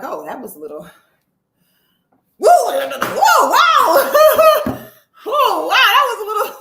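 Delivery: high, loud whoa breaking into a wow shout and a laugh; startled yelp. Retold or imitated. Telling the audience how the turbulence hit, high, loud shouted whoa. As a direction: jolt from surprise into a loud shout.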